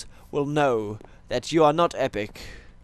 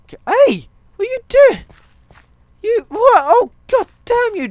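much higher, louder outraged yelling; high angry yell; 9 dB louder than usual. Real, not acted. Gamer yelling at his dog Jabba for messing up the farm, high angry shout. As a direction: yell in shock at the misbehaving dog.

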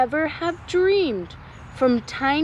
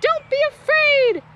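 much higher, loud encouraging call; rallying call; 5 dB louder than usual. Acted. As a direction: urge the troops on. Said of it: "called Dilly".

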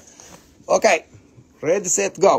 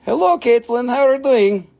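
loud, bright greeting to a viewer; excited hello; 6 semitones higher, 6 dB louder than usual. Real, not acted. Seller calling out to viewer Caitlin, excited. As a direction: call out a happy hello.

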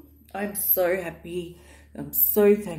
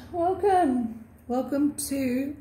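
slow, higher, sing-song welcome; drawn-out welcome; 6 semitones higher, slower than usual. Real, not acted. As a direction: stretch the welcome out fondly. Welcoming viewers to new home.

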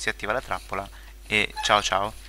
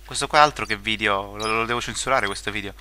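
ordinary delivery

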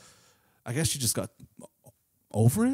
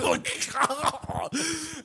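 big laugh. Real, not acted. Laughing hard at a friend's jab. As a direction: crack up at the joke. Friend's joke about drinking.

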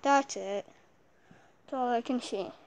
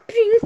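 high sound effect; 10 semitones higher, 14 dB louder than usual. Acted. Imitated hit noise.

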